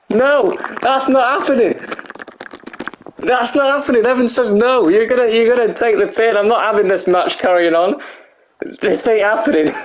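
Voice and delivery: high-pitched, fed-up refusal; exasperated protest. Real, not acted. Streamer refuses to let the match carry on, exasperated.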